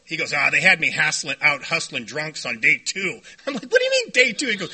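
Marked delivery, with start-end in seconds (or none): 0.3-3.2 put-on casual old-timer. Plays old-timer's casual answer. 3.6-4.5 high-pitched, astonished echo; incredulous question. Retold or imitated. Interviewer questions the old-timer's claim, high and incredulous.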